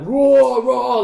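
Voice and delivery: loud, high mock roar; drawn-out roar, easing on the repeat; imitated crowd roar. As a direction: roar like a cheering dwarf army. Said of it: "you hear a big, like".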